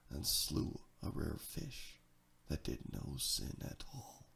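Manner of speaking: hushed, breathy whisper dropping low; whispered narration of a small tragedy; whispered aside. A narrator's reading. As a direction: let it fall to a whisper like a sad footnote.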